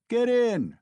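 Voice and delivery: high, loud shout. Acted. Mikolka urging the crowd aboard, high loud shout. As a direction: yell the order. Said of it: "shouted one of them".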